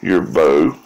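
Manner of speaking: usual speaking voice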